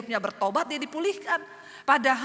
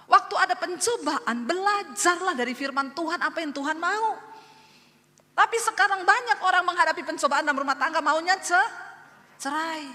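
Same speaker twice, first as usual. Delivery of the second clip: raised, high, forceful preaching; full-force preaching; 4 semitones higher, 5 dB louder than usual. Real, not acted. Pastor urging struggling couples to learn from scripture, full-force preaching. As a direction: press the point loudly from the pulpit.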